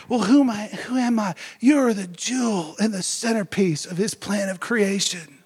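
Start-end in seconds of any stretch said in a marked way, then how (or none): none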